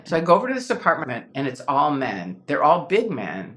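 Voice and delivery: ordinary talk, nothing marked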